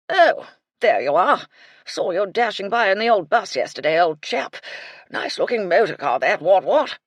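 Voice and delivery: put-on loud jovial old colonel; a cheery old soldier greeting a friend; hearty colonel. A narrator's reading. Colonel greeting Cyril, loud and jovial.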